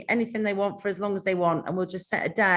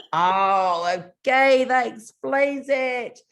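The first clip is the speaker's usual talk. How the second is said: drawn-out oh, slowly understanding; imitating a reader's slow realisation; mock realisation; 5 semitones higher, 6 dB louder, much slower than usual. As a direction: play the reader catching on, slowly. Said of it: "And they go".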